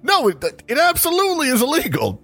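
hammed-up outraged correction; mock indignant.

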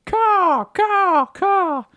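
high cawing crow; shrill screeching bird woman. Pretend. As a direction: caw like a crow. Bird caws in the ad.

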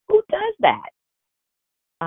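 very high, drawn-out disbelief; incredulous. Acted. Preacher scoffing at the other person's citizen's arrest, very high and drawn out. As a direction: stretch it out in shrill outrage at the absurdity.

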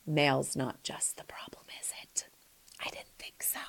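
barely audible, knowing undertone; hushed aside. Real, not acted. Advice host quietly answers her own question, hushed aside. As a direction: drop into a near whisper. Mock aside to letter writer.

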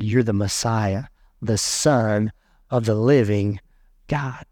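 low, slow, weighty confession; solemn declaration. Acted. Simon Peter answers Jesus, low solemn declaration.

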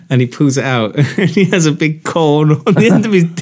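punchline pushed out high and laughing; can barely get it out for laughing. Real, not acted. Telling mate's shower story, laughing at the punchline.

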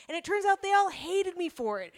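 ordinary talk

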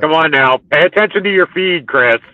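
loud, high-pitched scolding; yelling. Real, not acted. Host chiding Chris over his feed, yelling. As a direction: yell it like a bossy reprimand. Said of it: "stop yelling at me".

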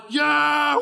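loud, high whoop of excitement; excited yelling. Retold or imitated. Rider yelling in line at Space Mountain, excited whoop. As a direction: let out a big theme-park yell. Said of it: "I start yelling louder".

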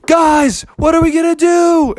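high-pitched worried cry; high, fretful bear voice; panicked bears. Acted.

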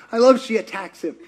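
ordinary delivery

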